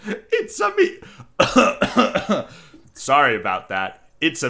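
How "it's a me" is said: very high, loud cartoon plumber voice; Mario. Pitching the movie's opening scene, high-pitched Mario impression. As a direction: squeak out a hammed-up Italian catchphrase. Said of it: "And he's like".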